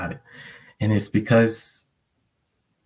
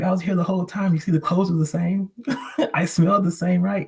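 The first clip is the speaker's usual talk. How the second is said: amused reply; 10 semitones higher than usual. Retold or imitated. Answering his dog, amused.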